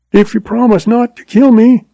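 high, panicked begging; blubbering. A narrator's reading. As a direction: plead for your life, pitched high. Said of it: "he blubbered".

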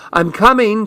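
high lilting call; singsong call. A narrator's reading. Gunny calling to the man with ice ready, singsong. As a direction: sing it out lightly, pitched well up. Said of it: "she sang".

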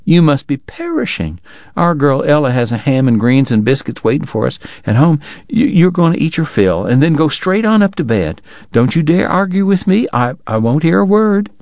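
doting wife greets the ragged traveler, high and fussing; fussing Southern lady. Acted. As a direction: coo over the sick boy in a high voice. Missus Segar insists on supper.

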